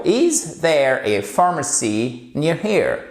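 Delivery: is there a pharmacy near here said slowly, teacher style; slow model sentence. Shown as a demonstration. Teacher reading a model phrase, slow and clear. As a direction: model the question slowly for learners to repeat. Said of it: "For example".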